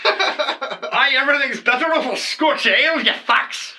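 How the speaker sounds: high-pitched, put-on Scottish accent. Co-host toasting a Scotch ale, mock Scottish accent. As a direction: ham it up as a high-voiced Scot. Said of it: "with that Scottish accent".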